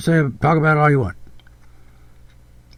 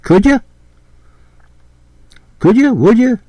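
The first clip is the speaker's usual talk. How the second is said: higher, rising "Could ya?" questions; mock innocent asking; 7 semitones higher, 3 dB louder than usual. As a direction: imitate a coaxing little query, pitched up. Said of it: "just asking a question like".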